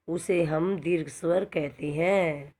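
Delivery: usual speaking voice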